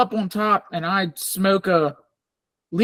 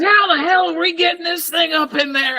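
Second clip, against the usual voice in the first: mock Tam; 9 semitones higher, 3 dB louder than usual. Tam quoted in story.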